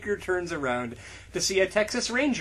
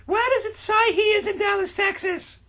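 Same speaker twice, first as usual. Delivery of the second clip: squeaky, much higher questioning voice; high Ron voice; 14 semitones higher, 3 dB louder than usual. Acted. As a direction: pitch way up as a baffled Ron.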